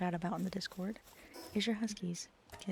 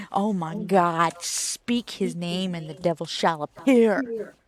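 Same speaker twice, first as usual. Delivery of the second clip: mock dramatic; 8 dB louder, slower than usual. Cat appears after being named.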